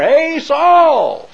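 loud call, pitched high up to carry down the hill; hollering. Acted. David calling down from the hill to Saul, loud holler. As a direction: shout it across to the camp below. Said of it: "hollered down there".

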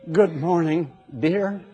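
soft, sugary holy voice; overly sanctimonious to the wife; husband sweetly asking wife for coffee, mock holy; mock pious husband. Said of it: "maybe they talk that way".

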